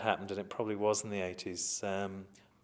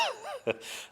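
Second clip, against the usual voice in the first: real laugh squeaking up high; high delighted laugh at his own trick; high burst of laughter. Real, not acted.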